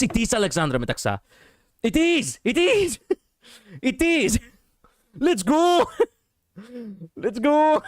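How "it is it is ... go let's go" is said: high, exultant cheer; elated cries breaking into laughter; triumphant excitement. Real, not acted. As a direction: celebrate being proved right, pitch way up. His guess at the actress confirmed.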